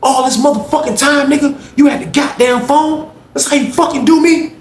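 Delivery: retold angry tirade; betrayed rage. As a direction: tear into him for the betrayal. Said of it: "Slim was like".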